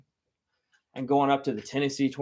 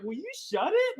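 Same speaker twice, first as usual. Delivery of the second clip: dad hushing; 3 dB quieter than usual. Retold or imitated.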